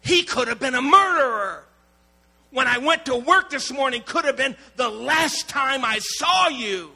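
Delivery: father's anguished shout. Retold or imitated. Father telling his daughter what could have happened, anguished shout. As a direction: shout the fear out at her. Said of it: "she starts crying".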